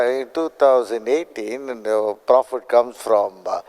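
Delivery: ordinary talk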